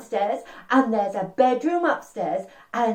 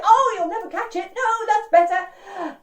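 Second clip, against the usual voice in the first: playful teasing encouragement; 10 semitones higher, 3 dB louder than usual. Pretend. Storyteller eggs on children chasing their tails, high and excited. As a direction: egg them on in a high voice.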